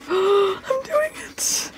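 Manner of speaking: high, slow, drawn-out whimper of "I'm doing it"; anxious cry. Real, not acted. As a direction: wail it out as you finally scratch. Scratching the card in suspense.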